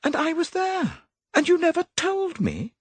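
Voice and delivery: high, hurt reproach; indignant young woman. A narrator's reading. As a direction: ask him, wounded. Molly upset, high.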